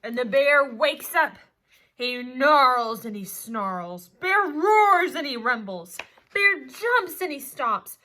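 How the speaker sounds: loud, building read of the bear waking; storyteller roaring the bear's rampage; loud dramatic reading. A narrator's reading. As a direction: boom it out as the bear wakes. Teacher reads the bear waking.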